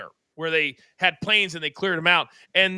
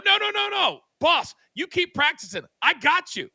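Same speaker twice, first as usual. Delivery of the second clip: much higher, eager "no, no, no"; eager insistence; 11 semitones higher than usual. Retold or imitated. Eager coach stopping boss from grabbing the can, high-pitched eager. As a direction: jump in brightly, I've got this.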